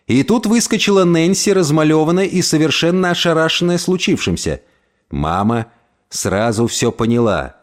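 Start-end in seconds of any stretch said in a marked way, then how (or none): none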